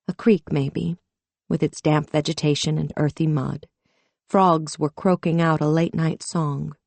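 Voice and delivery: usual speaking voice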